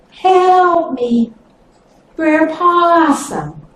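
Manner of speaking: high, drawn-out call for help; pleading snake voice. Acted. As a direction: call up from the bottom of a hole, begging. Snake calling from the hole.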